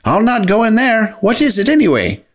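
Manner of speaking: mildly alarmed, high-pitched refusal; alarmed balking. A narrator's reading. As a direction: balk warily in a high voice. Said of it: "he cried in alarm".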